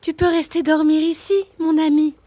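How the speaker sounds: high, loud, friendly turtle voice; turtle speaking. A narrator's reading. Turtle offers the warthog a bed, high and friendly. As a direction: play the sly little turtle, high. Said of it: "dit-elle au phacochère".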